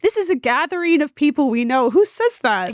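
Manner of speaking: mocking incredulity. Mocking the girl's words.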